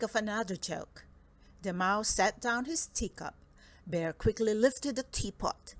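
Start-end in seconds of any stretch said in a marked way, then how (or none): none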